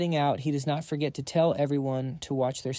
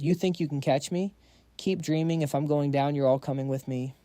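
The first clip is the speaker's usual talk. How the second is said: usual speaking voice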